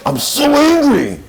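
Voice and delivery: a high, loud, drawn-out cry of "I'm so angry!"; mock angry outburst. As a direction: play the animal self losing it, slow and loud. Voicing the angry animal self.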